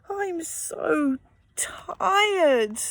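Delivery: sighing, fading into a whisper; exhausted sigh. Acted. Mum sitting on the floor, whispered exhaustion. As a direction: let it out on a worn-out breath.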